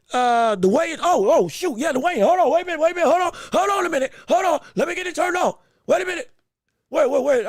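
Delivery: scrambling to catch the stream live; excited rush to find the weigh-in; excited scramble. Real, not acted.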